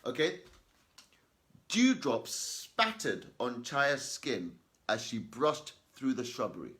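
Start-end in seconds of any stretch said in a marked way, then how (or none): 1.7-6.7 slow, deliberate reading aloud; careful classroom read-aloud; slow, loud and clear reading. Shown as a demonstration. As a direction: read each word slowly and clearly. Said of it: "I'm reading slower and I'm making it clear".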